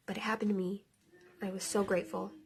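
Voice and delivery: hushed, choked-up whisper of gratitude; barely voiced, moved close to tears; tearful whisper. Real, not acted. Telling the camera of her near-drowning, a tearful whisper. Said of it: "I'm gonna cry".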